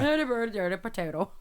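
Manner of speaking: gibberish in a mock Irish accent; bad Irish accent. Shown as a demonstration. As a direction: babble it as fake Irish. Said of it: "Let's hear your Irish accent".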